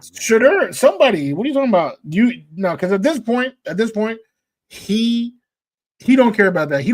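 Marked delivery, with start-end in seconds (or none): 0.0-3.9 heated. Real, not acted. Arguing receivers, raised voice.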